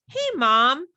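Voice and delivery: lightly voiced daughter; daughter calling Mom. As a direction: call out as a kid getting a parent's attention. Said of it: "And she goes".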